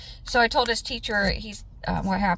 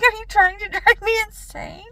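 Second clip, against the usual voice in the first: exasperated; 13 semitones higher, 5 dB louder than usual. Retold or imitated. Exasperated mom to son.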